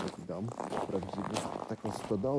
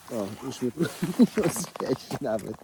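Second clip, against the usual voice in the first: laughing; 3 semitones higher, 6 dB louder, slower than usual. Real, not acted. Donkeys nibbling at him.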